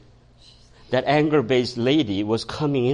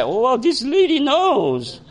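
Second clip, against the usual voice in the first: high, drawn-out mock realization; hammed-up inner alarm, stretched out high; comic dismay; 11 semitones higher than usual. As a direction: play a dawning panic, pitched way up and slow. His alarmed inner thought, played comically.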